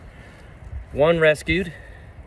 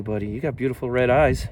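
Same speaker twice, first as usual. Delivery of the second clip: ordinary talk, nothing marked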